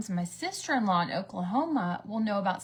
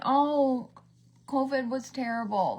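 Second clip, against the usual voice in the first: long whiny "oh", pitched up; exaggerated imitation of a whining star; mock whining; 4 semitones higher, 4 dB louder, slower than usual. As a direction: moan it in mock self-pity. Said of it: "seems like she whines a lot".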